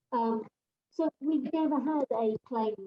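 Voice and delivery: ordinary delivery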